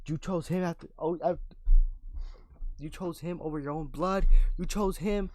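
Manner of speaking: hushed, hurt accusation to his own blood; hurt quiet plea. Retold or imitated. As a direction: plead softly like a betrayed brother. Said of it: "Roman's like".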